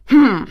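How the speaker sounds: loud grunt of rage; grunt, barely holding back; angry grunt. Acted. Thor grunts in rage at Odin. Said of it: "grunted Thor".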